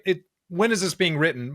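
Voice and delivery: ordinary delivery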